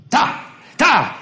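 high, short cries of alert; urgent call. A narrator's reading. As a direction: call out the sighting sharply. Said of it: "rief Wardle".

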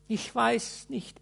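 high, slow rueful lament; wistful and contrite. A narrator's reading. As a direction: plead it sorrowfully. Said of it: "ganz wehmütig und zerknirscht".

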